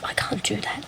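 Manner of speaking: whispered to self. Retold or imitated. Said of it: "And I'm just like".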